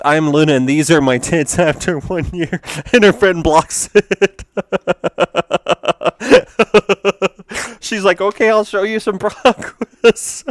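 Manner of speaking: high, loud, cracking up; laughing while reading. Real, not acted. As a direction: try to read on through helpless laughter. Cracks up reading the meme aloud.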